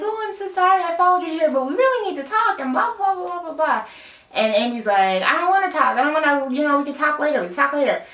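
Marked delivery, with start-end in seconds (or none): 0.0-3.8 fast, high-pitched imitation of a pestering friend; mock gushing apology. Retold pushy friend's gushing apology. 5.2-8.0 imitating a flustered refusal, raised pitch; mock brush-off. Retold friend brushing off a talk.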